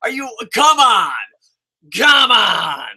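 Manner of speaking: loud, disbelieving protest; loud fed-up protest; exasperated come on. Real, not acted. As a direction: throw up your hands and yell. Exasperated shout.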